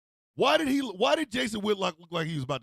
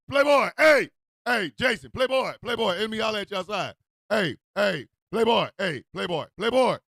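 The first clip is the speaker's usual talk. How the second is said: punchy, loud repeated call to get attention; street call-out; 5 dB louder than usual. Acted. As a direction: holler it across the street, each call falling away. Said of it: "You can't just yell Playboy".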